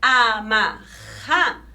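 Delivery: "Amarra" slowly as A-MA-RRA; long throaty friction R in "Amarra"; drawn-out strong R. Shown as a demonstration. Teacher hinting at two Rs, slow strong R. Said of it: "Dois R's".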